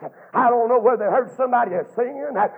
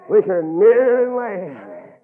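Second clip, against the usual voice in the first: slow declaration; 2 semitones lower, 4 dB louder, much slower than usual. Real, not acted.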